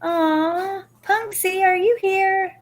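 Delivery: very high, drawn-out delighted coo; high-pitched, melting surprise; touched squeal. Real, not acted. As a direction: gush in a high voice at a dear friend. Touched by a viewer's surprise delivery.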